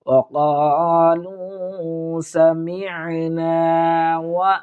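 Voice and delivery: Quran recitation. Acted. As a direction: recite the verse with full melody. Teacher recites whole verse. (শিক্ষক ছাত্রদের জন্য পুরো আয়াত সুর করে তেলাওয়াত করছেন)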